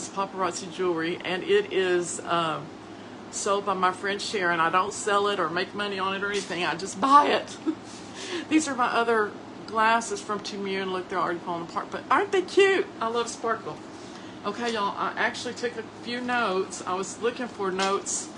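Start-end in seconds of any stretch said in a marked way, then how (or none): none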